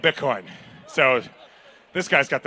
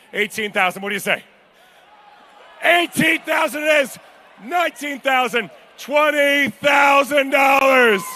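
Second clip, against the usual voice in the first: auction bid calling; 4 semitones higher than usual. Acted. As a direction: push the room, land the big bids. Auctioneer calling rising bids.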